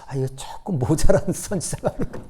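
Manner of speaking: a teasing whisper; conspiratorial whisper to the pews; whispered aside. Real, not acted. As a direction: lean in and whisper the joke.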